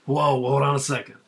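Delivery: loud, high hammed-up self-introduction; mock Topher Grace announcing himself. Friend mocking the actor's cameo, loud and hammed up. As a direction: shout it like a showman reminding everyone who he is. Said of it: "It's like, you know".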